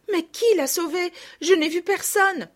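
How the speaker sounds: put-on high, loud little girl's voice; agitated child, pitched high; distressed questioning. A narrator's reading. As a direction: question it anxiously, still upset. Distraught girl questioning her mother.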